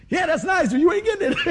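loud, high, laughing tease; voicing dad's sly glee, laughing loud; dad's gleeful thought. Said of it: "That's what he's thinking".

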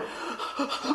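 sharp acted gasp of realisation; quick mock gasp; sudden intake of breath, acted.

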